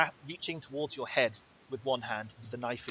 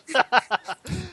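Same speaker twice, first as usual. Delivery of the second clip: evil thug laugh; 12 semitones higher, 8 dB louder than usual. Acted. Thug laughs after threat.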